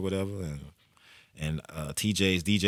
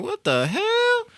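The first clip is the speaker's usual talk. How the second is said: very high, slow what the hell; long high-pitched astonished cry; drawn-out amazement; 4 dB louder, much slower than usual. Retold or imitated. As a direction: stretch it way up, blown away. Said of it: "I was like".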